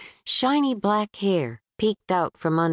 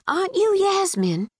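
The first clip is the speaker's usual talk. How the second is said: a lightly voiced friendly Southern girl; 13 semitones higher than usual. Natalie chasing passenger.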